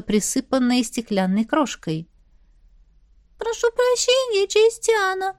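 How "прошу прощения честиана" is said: high, pitiful squeak; plaintive squeak. A narrator's reading. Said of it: "жалобно пропищала я".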